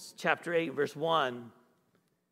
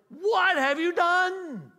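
high, loud, drawn-out mock parental outburst; scolding parent yell; 12 semitones higher, 8 dB louder, slower than usual. Preacher mimicking how he scolded his children, loud mock yell. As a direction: re-enact an appalled parent's yell, stretching each word.

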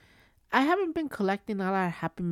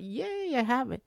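excited cheer; 5 semitones higher than usual. Retold or imitated. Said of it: "I was like".

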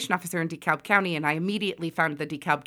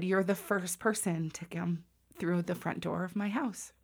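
moved to tears; 9 dB quieter than usual. Retold or imitated. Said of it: "tears running down her face".

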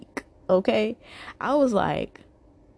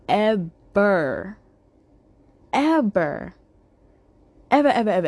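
each ever rising and louder, building to a loud peak; emphatic ever; 3 semitones higher, 4 dB louder than usual. Retold or imitated. To the rude man on the dating app, escalating emphatic evers. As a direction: stretch every ever, piling them up louder.

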